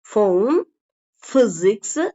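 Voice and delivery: ஃபோன், பிசிக்ஸ் with initial f, rising. Shown as a demonstration. Teacher repeating the correct forms for learners, with f. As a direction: start ஃபோன் and பிசிக்ஸ் with f, never p. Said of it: "அது ஃபா sound தான்".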